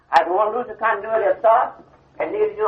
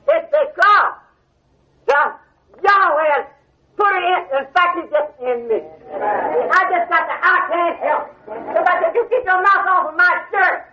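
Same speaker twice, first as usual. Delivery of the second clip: fervent preaching; 8 semitones higher than usual. Acted. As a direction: preach it from the heart, climbing. Preacher building to full force.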